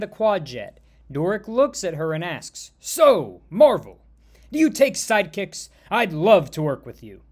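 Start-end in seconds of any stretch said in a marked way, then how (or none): 2.8-7.2 loud, punched-out pitch to a hero; loud and hopeful; eager fan. Acted. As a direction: ask like an overkeen would-be sidekick. Eager kid asks hero for job.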